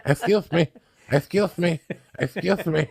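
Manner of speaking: lightly mimicked plea; mock calling out. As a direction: imitate a man calling after you. Imitating man calling after him.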